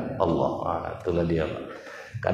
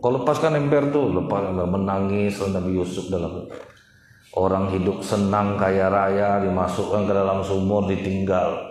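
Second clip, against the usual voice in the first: ordinary talk, nothing marked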